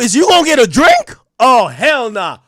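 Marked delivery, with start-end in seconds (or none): 1.4-2.4 slow, drawn-out shouted refusal; hell nah disbelief. Real, not acted. Streamer refusing hundred-dollar drinks, slow shouted disbelief. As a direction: stretch it out like no way.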